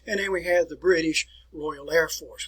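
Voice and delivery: ordinary talk, nothing marked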